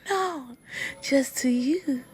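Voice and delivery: soft, breathy whispered answer; a hushed, flirty whisper. Retold or imitated. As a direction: whisper it like a secret compliment.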